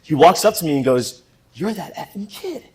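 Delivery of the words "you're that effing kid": high, hushed impression of an amazed billionaire; imitated recognition. Billionaire walks up to the returning intern, high hushed imitation. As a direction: mimic him in a small, raised voice.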